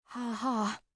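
dry sarcastic ha ha; deadpan fake laugh, sarcastic. A narrator's reading. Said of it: "Jade said sarcastically".

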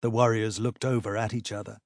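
usual speaking voice

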